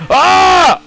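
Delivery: high loud groan of anger; real frustrated yell. Real, not acted. Racer yelling as the run slips away, frustrated yell. Losing time in race.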